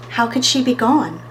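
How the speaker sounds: ordinary delivery, nothing marked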